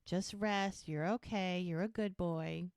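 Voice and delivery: slow, gentle soothing of an animal; very sweet. Retold or imitated. Doc calming the sick horse Sugar, very sweet. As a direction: imitate a doctor sweetly calming a patient. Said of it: "So Doc's being very sweet".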